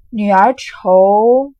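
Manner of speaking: dragged out slowly, loud and strained; strained blurt. Acted. Xue Pan, stuck for words, blurts loud and slow. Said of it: "薛蟠瞪了一瞪眼".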